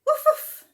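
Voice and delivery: high loud barking woof; dog noise. Pretend. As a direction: bark like a little dog. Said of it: "making the animal noises".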